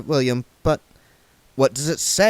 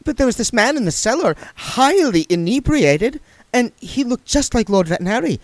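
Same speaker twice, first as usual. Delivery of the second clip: higher, louder, fast nervous babble; nervous rush; 12 semitones higher, 5 dB louder than usual. A narrator's reading. Saccharissa explains to William, nervous, fast and high. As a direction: gabble it out nervously. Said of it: "said Saccharissa nervously".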